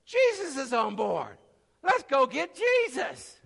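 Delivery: high, loud excited cry; light up as if the answer just hit; sudden realization. Retold or imitated. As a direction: disciples in the storm, suddenly excited. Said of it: "they did figure out".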